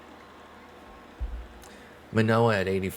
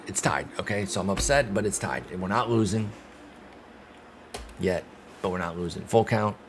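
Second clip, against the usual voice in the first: usual speaking voice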